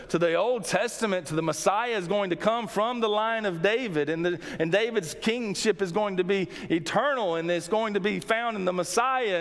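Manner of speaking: ordinary delivery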